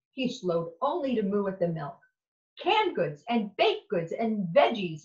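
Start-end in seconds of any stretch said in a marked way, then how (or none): none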